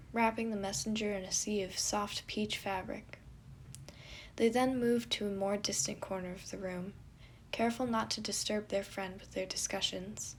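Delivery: usual speaking voice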